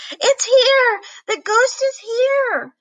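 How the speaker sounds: high, loud panicked cry; terrified yell. Acted. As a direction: scream that the ghost has come. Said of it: "yelled Bernard".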